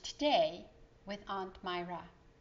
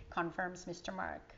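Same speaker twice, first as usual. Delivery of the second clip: plain reading voice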